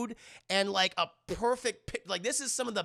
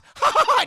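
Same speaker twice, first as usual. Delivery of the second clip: loud, high burst of laughter; imitated mocking laugh; 14 dB louder than usual. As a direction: laugh loudly at them, hammed-up.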